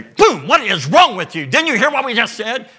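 the ladies scolding the disbelieving disciples, exasperated. Acted. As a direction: let them have it, fed up.

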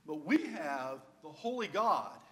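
slow, loud preacher's build; preaching. Acted. Preacher to the congregation, rising to a slow, loud close. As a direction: proclaim it from the pulpit.

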